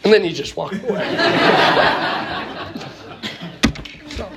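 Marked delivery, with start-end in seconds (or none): 1.1-4.1 high laughter. Real, not acted. Laughs at punchline.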